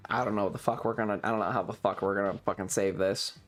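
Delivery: ordinary talk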